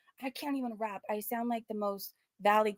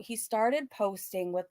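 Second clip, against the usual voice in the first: ordinary talk, nothing marked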